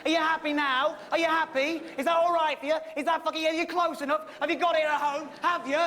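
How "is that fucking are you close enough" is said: ordinary talk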